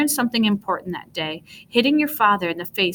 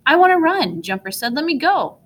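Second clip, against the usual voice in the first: eager dog; 7 semitones higher, 4 dB louder than usual. Acted. Eager dog straining to get free.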